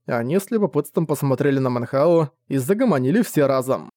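ordinary delivery, nothing marked

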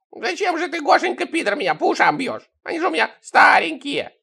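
put-on very high, tearful old-man whine; plaintive whine. A narrator's reading. Old spy complains about blows to his ears, high whining. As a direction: whine pitifully in a hammed-up falsetto. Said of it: "пожаловался шпион".

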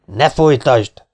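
loud, slow hoarse command. A narrator's reading. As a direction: cut her off forcefully, drawing the words out. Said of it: "mondta a férfi rekedten".

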